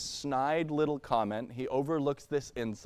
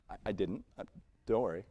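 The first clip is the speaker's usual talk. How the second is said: soft, lower, sheepish retreat; meek backing down; 8 semitones lower, 6 dB quieter, faster than usual. Retold or imitated. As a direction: back off quickly and softly. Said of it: "You're like".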